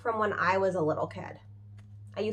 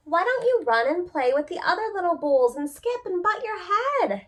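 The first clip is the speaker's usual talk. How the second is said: mother cow; 8 semitones higher, 3 dB louder than usual. Acted. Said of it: "she would say".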